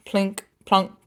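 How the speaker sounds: usual speaking voice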